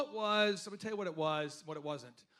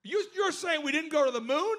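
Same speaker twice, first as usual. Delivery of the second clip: high, loud incredulous mockery; mocking accuser; 9 semitones higher, 8 dB louder than usual. Acting out a doubter scoffing at his moon claims, high and loud. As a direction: scoff at him like he's crazy.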